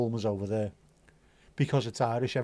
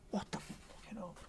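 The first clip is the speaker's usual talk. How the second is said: whisper; 7 semitones higher, 15 dB quieter than usual. Real, not acted. Sudden aside to stream.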